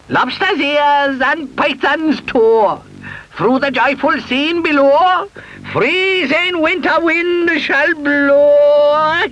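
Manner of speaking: high slow chanted spell; witch incantation. A narrator's reading. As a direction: intone it like a witch.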